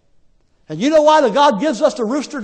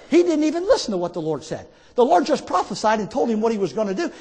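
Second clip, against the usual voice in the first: normal talking voice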